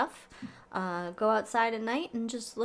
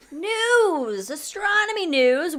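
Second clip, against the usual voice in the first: segment announcer; 9 semitones higher, 5 dB louder, much slower than usual. Acted.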